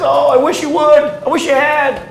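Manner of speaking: high, loud yearning cry; longing plea. Acted. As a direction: reach out with the wish, voice up high. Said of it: "So Jesus says".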